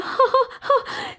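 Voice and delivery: short high trill of laughter; a bright little princess laugh; high mock laugh. Talia laughs after gift remark.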